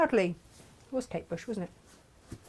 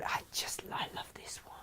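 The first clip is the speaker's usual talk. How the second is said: whispering; 18 dB quieter than usual. Real, not acted. Aside to herself.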